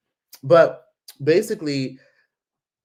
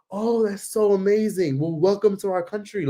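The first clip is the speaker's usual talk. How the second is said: gushing stranger; 7 semitones higher than usual. Retold or imitated. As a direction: mimic an eager local impressed by a visitor. Voicing stranger gushing welcome.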